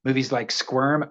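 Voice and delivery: ordinary talk, nothing marked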